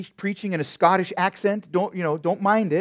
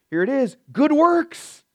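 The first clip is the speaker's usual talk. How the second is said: triumphant reveal; 9 semitones higher, 5 dB louder than usual. Real, not acted.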